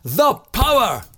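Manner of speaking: loud high shout. Shown as a demonstration. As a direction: shout it out triumphantly. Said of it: "and then you shout".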